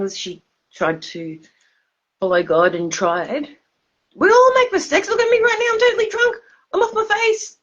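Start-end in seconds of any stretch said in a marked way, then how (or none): 4.1-7.6 tipsy squeal. Real, not acted. Admits being drunk on stream.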